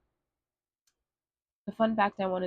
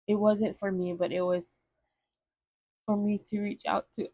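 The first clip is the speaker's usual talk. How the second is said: ordinary delivery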